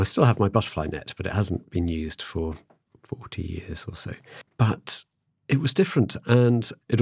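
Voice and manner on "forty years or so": whispered aside. Real, not acted. Aside about the unused butterfly net.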